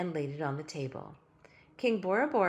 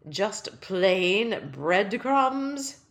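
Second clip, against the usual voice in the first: dejected; 2 semitones higher, 7 dB louder, much slower than usual. Acted. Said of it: "The king looked depressed".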